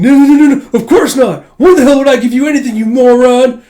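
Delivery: shouted high and loud, fast; furious denial. Retold or imitated. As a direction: yell it in a flustered rage. Damien angrily denying.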